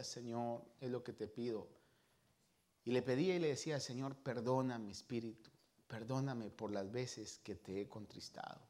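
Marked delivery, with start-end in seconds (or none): 0.0-1.7 soft, much quieter murmured plea to the Lord; retelling a private prayer, softly; hushed prayer. Retold or imitated. Retold quiet prayer in worship. 3.8-8.6 soft, much quieter plea for forgiveness; repentant, barely above a murmur; hushed prayer. Retold or imitated.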